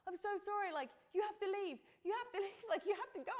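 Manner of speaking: high, half-laughing imitation of pleading; flustered, high-voiced mock apology; retold frantic plea. Retells begging gardeners to leave.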